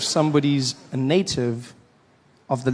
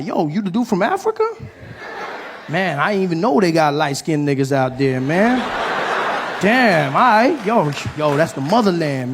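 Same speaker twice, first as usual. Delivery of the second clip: comic amazed at meeting an African; excited street voice; 3 semitones higher, 4 dB louder than usual. Retold or imitated. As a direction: hype it up like a revelation. Excited comedian in story.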